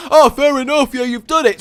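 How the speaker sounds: high, loud pretend acceptance; exaggerated easy agreement; mock impressed concession. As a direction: cheerfully give in, over the top. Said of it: "they're certainly not gonna be".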